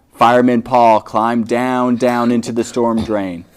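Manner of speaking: plain reading voice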